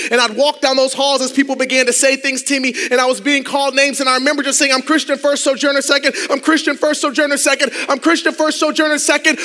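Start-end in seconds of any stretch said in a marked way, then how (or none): 4.7-9.4 building mantra. Retold or imitated. Repeating mom's mantra while bullied.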